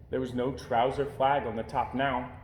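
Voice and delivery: ordinary delivery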